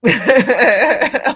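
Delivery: big real laugh, high and loud; loud burst of laughter. Real, not acted. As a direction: crack up at the chat. Laughing at things in chat.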